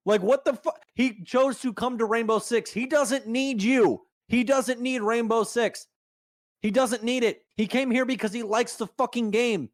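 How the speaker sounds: heated, insistent scolding; indignant rant. Real, not acted. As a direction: drive each point home, fed up. Angry defence of a mistreated host.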